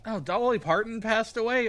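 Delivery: high, loud startled question; shocked surprise. Real, not acted. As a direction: react in shock to sad news. Hearing news of a death.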